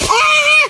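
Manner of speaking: high sharp cry; scared yelp. Acted. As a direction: yelp in fright. Kid scared up the tree.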